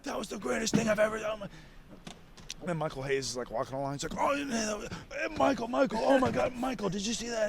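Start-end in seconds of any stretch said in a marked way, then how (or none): 0.0-1.4 high-pitched gushing impression, softly strained; Pat Patterson. Backstage cheerleader praising the wrestler, gushing. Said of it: "like, my biggest cheerleader in that moment". 4.2-7.5 high, excited mimicked voice; Pat Patterson. Cheerleader stopping Michael in the hallway, excited. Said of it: "like, my biggest cheerleader in that moment".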